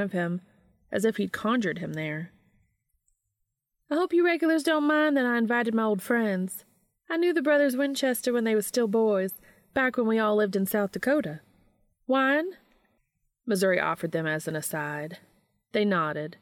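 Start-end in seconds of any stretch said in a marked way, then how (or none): 3.9-12.5 lightly voiced hostess, higher; a hint of a welcoming older lady; warm older woman. A narrator's reading. Missouri voiced in story.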